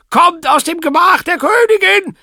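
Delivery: loud high roar; booming shout. A narrator's reading. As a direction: roar the command. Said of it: "dröhnte die Stimme".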